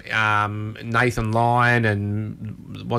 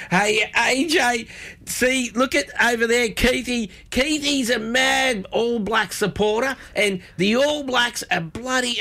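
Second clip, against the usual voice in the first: high, slow, rambling trainer voice; Bjorn Baker impression; 11 semitones higher, slower than usual. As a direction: play the trainer drifting off to chat with the crowd. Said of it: "he'll go".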